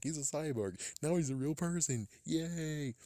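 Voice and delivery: sarcastic high chirpy voice; hammed-up fake enthusiasm; mock cheer. Mocking the show's plot.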